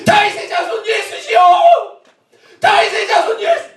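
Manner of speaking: very high, straining cry. Acted. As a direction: shout over the crowd for mercy. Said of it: "더 크게".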